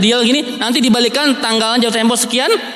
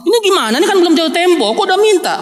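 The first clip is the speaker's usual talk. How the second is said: indignant protest; 8 semitones higher, faster than usual. Acted. Jamaah kesel pada Yahudi.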